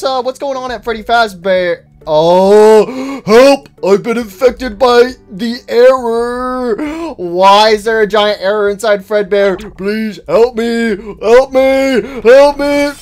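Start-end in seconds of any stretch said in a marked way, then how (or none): 2.1-12.9 voiced distressed character. Character infected by the error.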